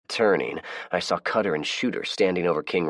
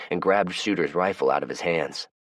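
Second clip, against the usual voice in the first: plain reading voice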